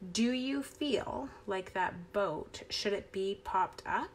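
usual speaking voice

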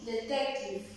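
"detective" said the English way; English word. Shown as a demonstration. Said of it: "Detective en inglés se dice".

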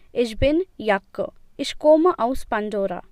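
"Jacke" said with a German J, like "Yakke"; Jake as Jacke. Shown as a demonstration. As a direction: read "Jacke" the German way, with a Y sound at the start. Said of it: "I read Jake as Jacke".

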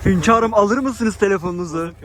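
slow, loud, hammed-up courtly bow in the voice; mock servile waiter. To his friend at the table, a hammed-up servile waiter. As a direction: play the groveling servant addressing his sultan.

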